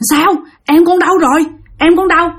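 high, urgent worried questions; anxious mother. Retold or imitated. Mother anxiously asks where her younger son is, high and urgent. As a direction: ask fast and high, fearing the worst.

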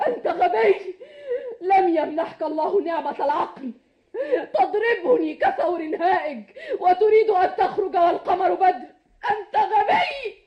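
crying. Acted. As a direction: scold him through sobs. Beaten wife reproaching through tears. (بعتاب باكٍ)

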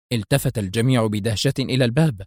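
plain reading voice

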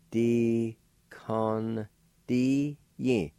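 "Di con di yin" slowly, syllables closer together; nasalized N in "con", each syllable held; slow nasalized pronunciation. Shown as a demonstration. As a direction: say "Di con di yin" evenly and drawn out. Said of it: "with a K A like this".

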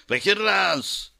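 high, drawn-out bellow; roaring demon cry. A narrator's reading. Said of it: "взревел Мудзу".

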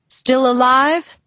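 slow, lightly voiced father; gruff dad check-in. A narrator's reading. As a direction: ask it slowly, dryly. Father checks on sick daughter.

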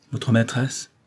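ordinary delivery

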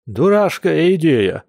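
high, drawn-out disgruntled grumble; grumbling. Acted. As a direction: complain slowly with the pitch pushed up. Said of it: "проворчал Яр".

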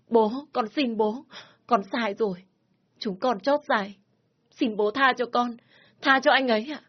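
high, hurried begging; frightened plea. Acted. Daughter begging her father to spare her lover, frightened. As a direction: plead quickly in fear. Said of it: "Hoài sợ hãi. Cô vội nói".

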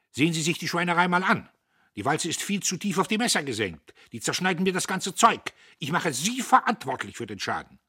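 high, loud tirade; furious boss dressing someone down; angry shouting. A narrator's reading. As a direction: shout the blame at a subordinate. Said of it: "schrie er".

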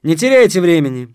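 firm, loud guard's instruction; stern order. A narrator's reading. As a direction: order them briskly to get on with it. Guard hurries the visitor.

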